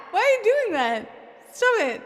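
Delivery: high, loud, flustered pleading; exasperated protest. Real, not acted. Streamer begging a generous donor to stop, flustered and loud. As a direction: beg them to stop, laughing it off.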